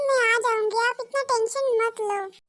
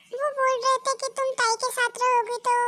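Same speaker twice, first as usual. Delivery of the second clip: usual speaking voice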